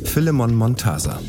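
ordinary delivery

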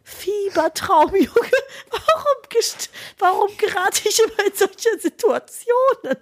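high, slow, half laughing, half crying; a comic wail at fate; despairing disbelief. Retold or imitated. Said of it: "dachte so".

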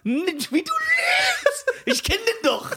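high-pitched, drawn-out outcry through laughter; incredulous laughing accusation. Real, not acted. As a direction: call out the lie, voice shooting up. Laughing outburst accusing friend of lying.

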